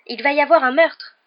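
high, loud cry of alarm; alarmed exclamation. A narrator's reading. Boy hearing a killer's voice, loud alarm. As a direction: cry out the warning urgently. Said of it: "s'exclama Harry, l'estomac noué".

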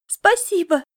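high, loud happy thanks; boy cheered by her promise, joyful. Acted. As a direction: burst out gladly like a boy. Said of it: "повеселевший мальчик".

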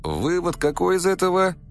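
ordinary delivery, nothing marked

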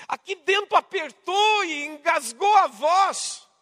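slow, loud, drawn-out emphasis; impassioned preaching. Real, not acted. Preacher telling how Jesus nearly wept, impassioned. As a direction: press each word out slowly and forcefully.

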